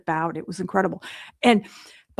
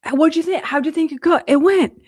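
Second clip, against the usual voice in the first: eager Jeff; 7 semitones higher, 5 dB louder than usual. Retold or imitated. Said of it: "Jeff's like".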